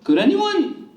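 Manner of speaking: suddenly high and eager, pitch shooting up; eager high excitement. Retold or imitated. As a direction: gush with sudden eager interest. Mock-supportive friend gets eager.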